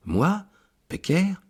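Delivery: high, stunned outcry; dismayed Provençal exclamation, pitched high; shocked cry. Acted. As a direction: gasp it out in disbelief, way up high. Priest aghast at empty heaven.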